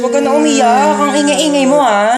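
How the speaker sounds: exasperated hushing. Acted. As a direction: tell off a noisy baby, patience gone. Fed up with crying baby. (naiinis na pagsaway)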